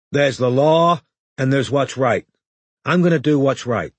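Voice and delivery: earnest American actress line; American woman voice. A narrator's reading. American TV voice through window.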